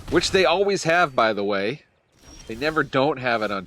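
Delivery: exasperated. Real, not acted. Complaining about opponent's cat.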